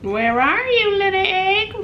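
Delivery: high sing-song to a little egg; cutesy baby talk. Acted. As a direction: coax the egg like a pet. Talking to food, hunting egg.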